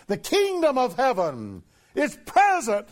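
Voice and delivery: high, loud proclamation of the kingdom; preaching shout. Real, not acted. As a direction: ring it out to the congregation.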